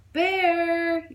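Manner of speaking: excited shout; child's yell. A narrator's reading. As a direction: call out to Bear across the yard. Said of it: "Jack yelled".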